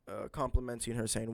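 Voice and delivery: usual speaking voice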